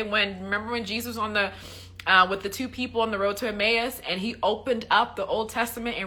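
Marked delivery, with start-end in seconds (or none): none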